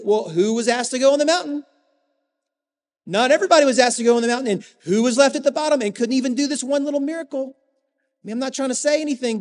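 lightly acted boastful squabbling; mock arguing. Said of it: "They are arguing about this".

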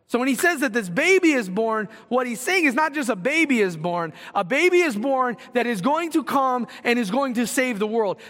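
full-force sermon build; fervent preaching. Acted. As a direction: press the point home to the congregation. Preaching with rising intensity.

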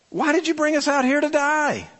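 high, fast whining grumble; hammed-up complaining Israelites; mock whiny complaint. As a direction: whine it like an ungrateful crowd. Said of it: "they're like".

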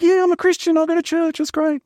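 very high, sustained monotone pitch; eager mock reply. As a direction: chirp it high on one note, mocking your own eagerness. Acted eager reply in anecdote.

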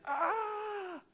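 high, thin held "aah"; soft strained cry of inner torment; anguished wail. Acted. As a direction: let out a long high-pitched moan, held back in volume. Mimics anguish of inner back-and-forth.

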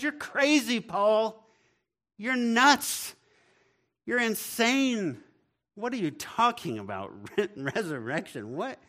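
high, loud mocking disbelief; scoffing Festus. Festus mocking Paul's resurrection claim, scoffing loud. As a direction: scoff at him like he's lost it. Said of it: "He says".

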